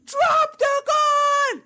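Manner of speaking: high, slow imitated shout; police yell. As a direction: bark the order like a cop. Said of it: "the police officer yell".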